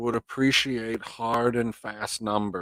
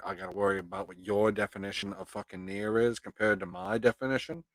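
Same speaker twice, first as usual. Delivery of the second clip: usual speaking voice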